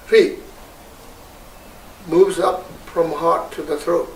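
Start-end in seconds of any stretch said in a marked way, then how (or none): 0.1-0.4 "Hri" voiced higher and louder; a bright call of "Hri" upward; seed syllable Hri. Shown as a demonstration. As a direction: ritual syllable "Hri" called out. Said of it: "seed syllable".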